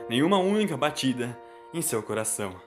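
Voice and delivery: plain reading voice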